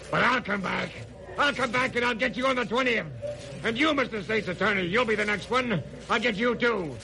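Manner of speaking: rising shouted threats of revenge; vengeful raving. Acted. Condemned killer raves at the jury, shouting. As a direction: rant at the whole court, building.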